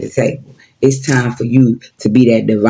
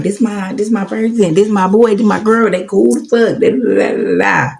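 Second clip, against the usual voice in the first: much higher, gushing over a new friend; mock hyped friend; 8 semitones higher than usual. Imitating someone hyping up new friends: high gushing voice. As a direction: squeal about your new best people. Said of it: "You'll be thinking they cool, vibing".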